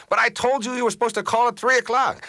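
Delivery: high, loud indignant scolding; angry complaint. Acted. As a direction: hit back with an acted, exasperated accusation. Acted complainer hitting it back.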